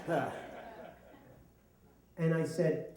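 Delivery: ordinary talk, nothing marked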